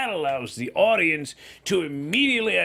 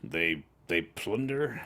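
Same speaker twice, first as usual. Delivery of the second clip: low dramatic voice; 10 semitones lower, 7 dB quieter, slower than usual. Pretend. Low dramatic pirate word.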